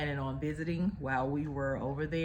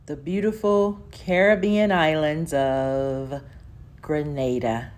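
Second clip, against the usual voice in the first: big loud announcement of Grenada; excited reveal; 9 dB louder than usual. Acted. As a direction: belt out the destination like a reveal. Announcing the vacation destination.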